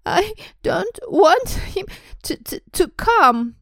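high, loud fake sobs, stuttering; slow, hammed-up bawling; pretend sobbing yell. A narrator's reading. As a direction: wail it like a spoilt child faking tears. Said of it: "yelled between huge pretend sobs".

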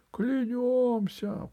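high, drawn-out solemn cry; crowd oath. A narrator's reading. The hall swearing loyalty to Alice, solemn cry. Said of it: "прокатилось по залу".

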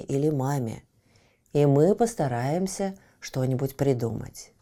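low, tender, reassuring dad; gentle father. Acted. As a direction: soothe the son kindly. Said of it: "нежно лизнул его в нос и сказал". (ласковый папа)

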